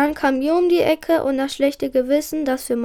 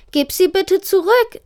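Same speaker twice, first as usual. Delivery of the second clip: pleading, higher and a little louder; urging; 6 semitones higher, 4 dB louder than usual. A narrator's reading. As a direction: press him to give them back. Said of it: "drängte Ida". (drängend, höher)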